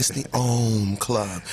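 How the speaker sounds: slow, stretched-out "Ohm Club"; over enunciating. Shown as a demonstration. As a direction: mouth "Ohm Club" slowly, syllable by syllable. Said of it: "watch my lips when I say it".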